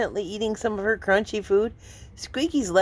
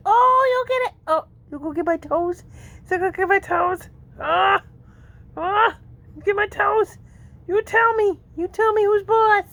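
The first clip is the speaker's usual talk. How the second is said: high pet talk; 9 semitones higher than usual. Real, not acted. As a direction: tease the kitten. Playing with kitten.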